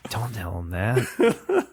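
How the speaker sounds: slow, playful stage whisper; mock-secret whisper. Podcast host hushing co-host's AI joke, mock whisper.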